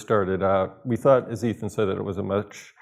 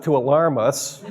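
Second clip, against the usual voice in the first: ordinary talk, nothing marked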